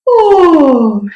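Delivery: a demo of a yawn's open sound; yawning oh. Shown as a demonstration. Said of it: "I like to think about".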